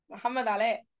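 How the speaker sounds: ordinary delivery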